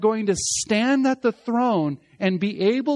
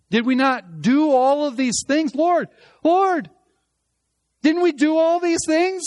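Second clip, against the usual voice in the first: higher, louder pleading with the Lord; flehentlicher Protest; 8 semitones higher, 5 dB louder than usual. Acted. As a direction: protest urgently, voice raised in disbelief. Voices the rejected protesting to Lord.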